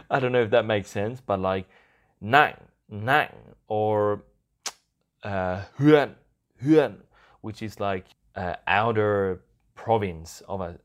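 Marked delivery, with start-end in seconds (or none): 2.2-3.3 "nặng" said quick and loud, then stopped dead; abrupt stopped tone. Shown as a demonstration. Host explaining a word that just stops, "nặng" cut off. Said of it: "the word just stops". 5.8-6.9 "huyện" short and loud, ending suddenly; abrupt stopped tone. Shown as a demonstration. Host giving another example, "huyện" said quick and stopped. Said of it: "the word just stops".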